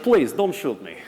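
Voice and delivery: hammed-up Russian-accented plea; mock Russian accent. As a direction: plead in a comic Russian accent. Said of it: "I just learned the accent".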